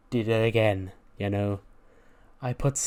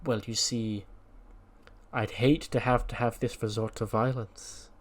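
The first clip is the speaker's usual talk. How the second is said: calm, measured menace; 4 dB quieter than usual. Acted. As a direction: answer a threat gently, with long pauses. Calm leader replies to a threat.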